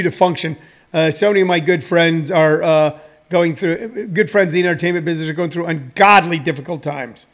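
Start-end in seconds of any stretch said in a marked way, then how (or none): none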